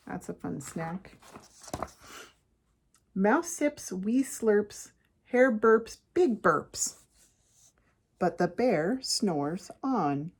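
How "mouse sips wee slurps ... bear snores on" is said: rhythmic picture-book read-aloud, slowing on the refrain; story narration. A narrator's reading. Parent reads a bedtime book to kids, storytelling. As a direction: read it to children in punchy phrases.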